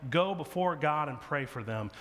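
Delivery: usual speaking voice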